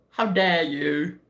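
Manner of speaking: drawn-out, theatrical indignation; mock offended. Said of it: "I'm offended".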